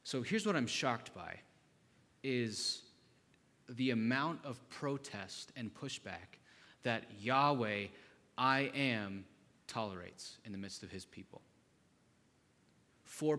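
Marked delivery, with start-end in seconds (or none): none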